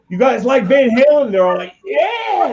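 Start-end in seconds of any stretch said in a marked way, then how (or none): 0.1-1.3 high-pitched imitation of a band leader; hyping frontman. Singer to talent show crowd, hyping them up. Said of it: "and he goes". 1.8-2.5 high, drawn-out imitation cheer; crowd freaking out. Talent show crowd answering the band, wildly excited. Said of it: "They're all freaking out".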